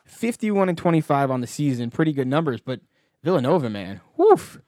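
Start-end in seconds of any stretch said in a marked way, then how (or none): 4.2-4.6 yelped high and loud in dismay; pained exclamation. Real, not acted. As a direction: wince out loud at a bad record. Villanova's bad record, wincing exclamation.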